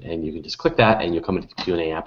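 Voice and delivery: ordinary talk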